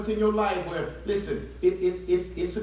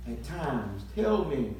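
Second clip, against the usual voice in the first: soft tender; 6 semitones lower, 7 dB quieter than usual. Real, not acted. Preacher drops to soft.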